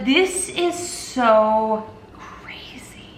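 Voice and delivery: slow, hushed whisper. Real, not acted. Vlogger about to open her DNA results, slow whisper. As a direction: breathe it out slowly in awe. Nervous before clicking results.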